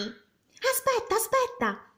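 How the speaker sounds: high, slow pleading call; a girl calling the dragon back; princess calling out. A narrator's reading. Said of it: "gridò Elizabeth".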